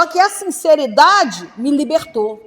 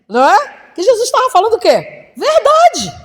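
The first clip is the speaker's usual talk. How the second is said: high, loud preaching peak; preaching at full force; 8 semitones higher, 8 dB louder than usual. Real, not acted. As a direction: drive the truth home with fire. Preaching climax on truth.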